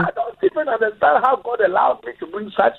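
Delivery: high, exasperated dad tirade; dramatic African dad. Retold or imitated. Son hears a dramatic rant from his dad, exasperated and high. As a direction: ham up a fed-up dad's lament. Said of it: "it's a performance".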